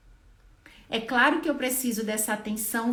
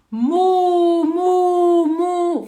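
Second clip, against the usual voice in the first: loud, higher "mu, mu, mu" drawn out; repeated loud moos; 7 semitones higher, 11 dB louder, much slower than usual. Shown as a demonstration. Therapist modelling repeated moos for a patient. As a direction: moo over and over for the child to copy. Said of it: "ó".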